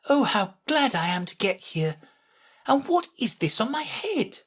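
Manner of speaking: high-pitched, puzzled Alice; dismayed exclamation. A narrator's reading. Alice feeling something on her head, high and dismayed. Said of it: "exclaimed in a tone of dismay".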